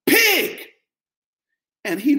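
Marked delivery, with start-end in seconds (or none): 0.0-0.7 much higher, loud shouted "Pig!"; sharp shout from the roadside; yelled insult. Retold or imitated. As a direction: fling the word out like a roadside jeer. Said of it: "man yells out".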